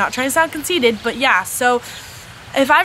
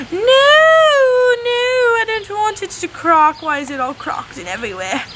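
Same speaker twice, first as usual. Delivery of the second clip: British accent; 10 semitones higher, 3 dB louder, slower than usual. Real, not acted. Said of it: "Why am I talking in the British accent?".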